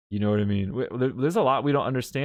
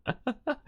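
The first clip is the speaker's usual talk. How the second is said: high giggle; 14 semitones higher, 6 dB quieter than usual. Real, not acted. Laughs at own slow pace.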